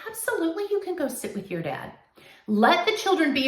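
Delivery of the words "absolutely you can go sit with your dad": cheerful agreement. Pretend.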